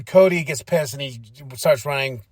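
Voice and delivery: ordinary delivery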